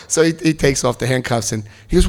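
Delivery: ordinary delivery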